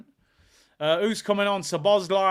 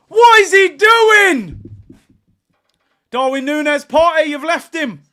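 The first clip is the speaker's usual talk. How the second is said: frantic shouting; 11 semitones higher, 14 dB louder than usual. Real, not acted. Tense late Liverpool attack.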